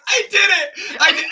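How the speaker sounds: high, loud mock victory cry; hammed-up high-pitched exclamation; retold triumphant outburst. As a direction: blurt it out, imitating her triumph. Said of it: "And she's like".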